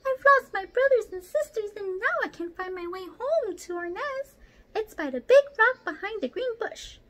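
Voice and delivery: squeaky mouse. Acted. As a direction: squeak like a scared baby mouse. Little mouse voice continues.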